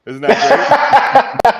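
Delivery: slow, gloating laugh through the words. Real, not acted. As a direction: savor the joke while cracking up. Teasing co-host, laughing.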